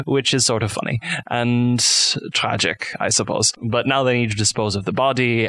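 usual speaking voice

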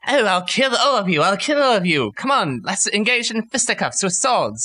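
high, mocking would-be fighter voice; mocking brawler. Listener mocks brandishing a poisoned blade, mocking bluster. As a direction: put on a silly blustering challenge. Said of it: "and be like".

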